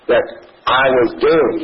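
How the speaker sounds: ordinary delivery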